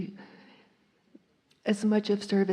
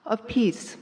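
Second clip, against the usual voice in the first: normal talking voice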